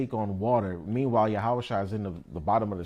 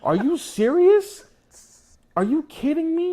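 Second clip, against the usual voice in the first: incredulous; 11 semitones higher, 6 dB louder than usual. Retold or imitated. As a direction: sound utterly floored. Disciples' amazement voiced.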